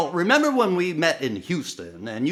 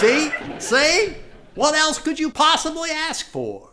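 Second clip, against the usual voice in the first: eager insistence; 11 semitones higher than usual. Acted. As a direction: press them to agree. Pushing sandwiches as sterile.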